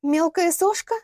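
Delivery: high МЕЛКАЯ СОШКА rising into a question; incredulous echo. Acted. Лилиана echoes the girl's insult, incredulous question. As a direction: throw the phrase back as a question.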